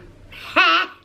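sudden high, loud laugh; real high-pitched cackle; burst of laughter. Real, not acted. Amused by a friend's comment.

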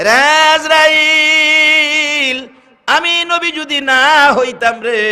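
high, loud, long-drawn chanted cry; soaring sing-song sermon lament; anguished Prophet, preached. Acted. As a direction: wail the name out in acted agony. Weeping Prophet cries to Azrael.

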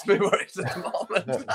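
breaking into a laugh mid-sentence; amused, words giving way to laughter. Real, not acted. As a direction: joke at your own expense, laughing through it. Joking about own overdue haircut.

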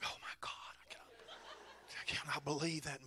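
hushed mock-scandalized whispering; shocked whisper. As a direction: whisper it like an appalled congregation. Congregation's shocked reaction, retold.

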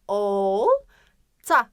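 "olta" with a long loud "ol", pause, short "ta"; drawn-out initial O. Shown as a demonstration. Teacher asking where O falls, long first o in "olta". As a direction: hold the o of "olta" before finishing it. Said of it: "Tabii ki de başında".

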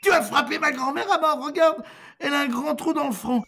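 very high, loud shouting; outraged accusation. A narrator's reading. Grandson accusing innkeeper of killing grandmother, high outraged shout. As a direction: accuse at the top of your voice.

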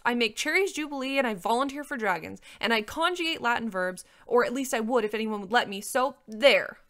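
defiant princess retort; snapping. A narrator's reading. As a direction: rattle off proof defiantly, ending loud. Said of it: "Cimarine snapped".

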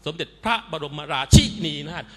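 "สมเด็จพระบรมราชินีนาถ" with "พระ" and "ชิ" punched high and loud; mock newsreaders hitting short syllables hard in "สมเด็จพระบรมราชินีนาถ"; mimicked overstressed pronunciation. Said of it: "ออกกันทุกช่องเลย".

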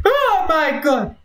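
high, drawn-out scared cry; startled out of your wits; frightened shriek. Real, not acted. Jump scare in game.

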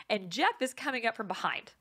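usual speaking voice

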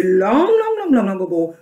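exaggerated emphasis. Real, not acted. Teacher telling how long musicians used it, exaggerated swooping "long".

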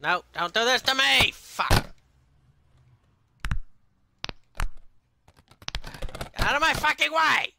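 high, loud furious yelling at the game; furious shout. Real, not acted. Gamer yelling at the bread blocking his way, furious high shout. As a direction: scream it out, losing it completely. Gamer raging at failed move.

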